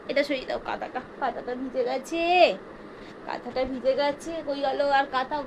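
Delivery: half to herself, very softly; mother murmuring softly while changing the wet blanket. Real, not acted. Soft murmur while changing baby.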